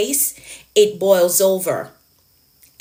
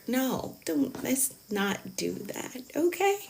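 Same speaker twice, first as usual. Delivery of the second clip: much softer, slow hushed plea; 3 semitones higher, 11 dB quieter, slower than usual. Acted. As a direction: pull it right down, almost to yourself. Hushed self-correction.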